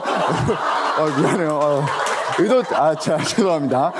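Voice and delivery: entschuldigt sich lachend; echtes Lachen. Real, not acted. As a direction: lach über den eigenen Versprecher. Prediger lacht über Versprecher.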